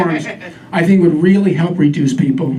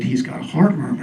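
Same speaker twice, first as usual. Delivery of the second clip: somber vet; 4 semitones lower, 6 dB quieter than usual. Retold or imitated. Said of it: "the vet said".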